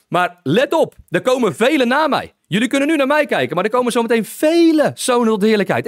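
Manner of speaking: high, driving preacher's cry, building; fiery preaching. Acted. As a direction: preach it as Jesus foretelling the harvest. Preaching Jesus' words, rising. (vurig prekend, steeds hoger)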